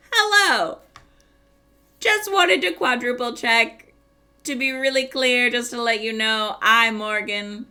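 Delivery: reading her own overly polite message aloud; mock formal message. Retelling the message she wrote to the clinic, mock formal. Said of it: "I'm pretending to be Morgan always".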